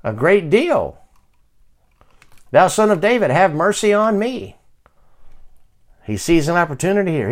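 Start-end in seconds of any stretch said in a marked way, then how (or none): 2.5-4.5 louder, high-pitched plea from the first word; insistent high call for mercy; crying out more. Acted. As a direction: cry out all the more for mercy. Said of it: "he cried the more".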